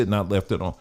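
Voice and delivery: normal talking voice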